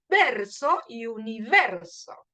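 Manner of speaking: VERSO and UNIVERSO slowed, VER stressed. Shown as a demonstration. Teacher showing a rhyme, strong E in both words. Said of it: "La E es la que suena fuerte".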